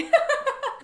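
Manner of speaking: high, loud laugh bursting out; real burst of laughter. Real, not acted. Vlogger laughing at a funny book detail, high loud laugh. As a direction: let a real laugh break through. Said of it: "remember laughing at".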